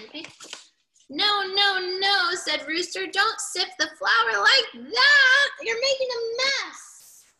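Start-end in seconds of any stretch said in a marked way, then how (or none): 1.1-2.3 high, raised-voice refusals; scolding protest. A narrator's reading. Reader voices Rooster stopping the messy sifting, scolding. 3.1-7.2 high, loud storybook telling-off; exasperated scolding. A narrator's reading. Reader voices Rooster about the flour mess, scolding.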